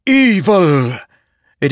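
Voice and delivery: high, loud, eerie cry of triumph; a hungry blade gloating; exulting sword. A narrator's reading. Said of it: "while the sword exulted".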